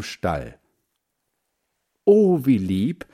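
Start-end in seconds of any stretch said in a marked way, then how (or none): 2.0-3.1 louder, higher, drawn-out coo of delight; delighted exclamation. A narrator's reading. Margret on seeing the rabbits, exclaiming in delight. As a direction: exclaim slowly over something sweet. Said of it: "rief Margret".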